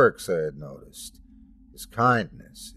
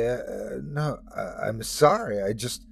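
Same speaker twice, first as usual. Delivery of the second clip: ordinary delivery, nothing marked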